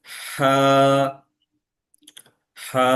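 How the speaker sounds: long হা with air pushed from mid-throat; throaty হা. Shown as a demonstration. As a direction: press breath out through হা. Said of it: "আমি উচ্চারণটা কিভাবে করছি একটু দেখবেন". (গলার মাঝ থেকে বাতাস ছেড়ে হা)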